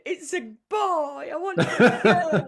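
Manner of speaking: high, loud, slowed tearful wail through laughter; retold crying wail. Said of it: "And crying".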